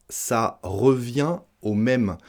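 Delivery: said slowly, word by word; slow model phrase. Shown as a demonstration. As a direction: lay it out slowly for learners. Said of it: "Ne cherchez pas à traduire". (lentement, pour bien articuler)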